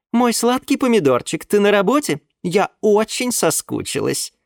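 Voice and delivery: put-on high, cooing young woman; sweet girlfriend voice. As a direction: coo it to your sugar daddy. Nymph voiced on the phone. (воркующая блондинка)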